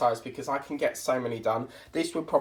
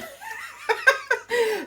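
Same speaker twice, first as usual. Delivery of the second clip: high laugh. Real, not acted. Laughs at own spending.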